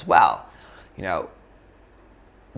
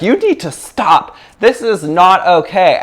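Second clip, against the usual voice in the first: louder, higher and slow, every word set down; firm command shout; 7 semitones higher, 8 dB louder than usual. Retold or imitated. Blocking the men from the girl, slow loud commanding shout. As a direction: plant your feet and order them to stop. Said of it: "It's like".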